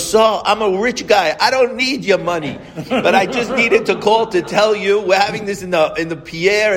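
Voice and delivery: a rich man bragging about his million dollar affair; proud rich father. Retold or imitated. Caller explaining, now half-laughing.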